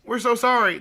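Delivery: high, exaggerated mock apology; high-pitched put-on remorse; mock repentant women. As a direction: imitate women gushing a fake apology. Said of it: "all of a sudden be like".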